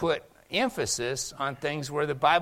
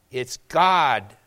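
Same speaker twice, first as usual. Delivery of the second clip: ordinary talk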